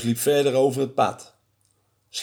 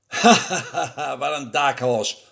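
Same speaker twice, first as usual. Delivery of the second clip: laugh, then talk through it; amused, mocking chuckle into the words; laughing at the fool who just fled; laughing mouse. A narrator's reading. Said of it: "lachte de muis".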